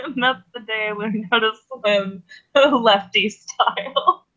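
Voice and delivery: light laughs breaking up the sentence; chuckling a little. Acted. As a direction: chuckle through a fond, silly memory. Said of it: "chuckling a little bit".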